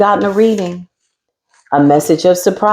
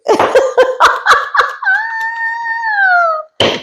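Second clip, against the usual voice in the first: shrieking burst of laughter. Real, not acted. A high laughing shriek over her own slip.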